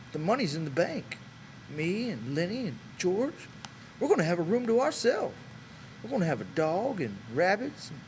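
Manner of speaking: Candy describing the farm they'll own; impassioned old man. A narrator's reading. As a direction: pour out a lifelong dream. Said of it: "Candy cried".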